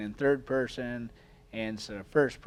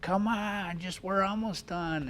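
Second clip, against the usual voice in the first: recruits yelling; 9 semitones higher than usual. Retold or imitated. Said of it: "kids would yell".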